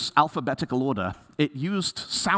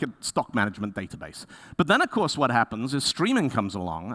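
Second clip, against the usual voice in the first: ordinary talk, nothing marked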